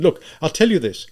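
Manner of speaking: urgent, inspired announcement; sudden inspiration. A narrator's reading. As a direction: burst in with a new thought. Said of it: "with a kind of inspiration".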